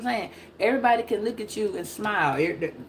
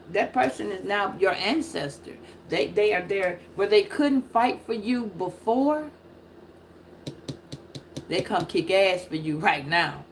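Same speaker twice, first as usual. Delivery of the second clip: ordinary talk, nothing marked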